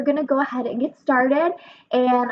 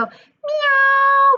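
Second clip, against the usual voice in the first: much higher, drawn-out cute kitty meow; cat meow; 6 dB louder than usual. Shown as a demonstration. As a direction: meow like a little cat calling out. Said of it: "Can you go".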